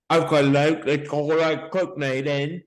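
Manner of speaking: exaggerated put-on Cockney; Cockney accent. Shown as a demonstration. Answering a friend's boast with a Cockney accent. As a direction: lay the Cockney on thick. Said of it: "is a Cockney accent".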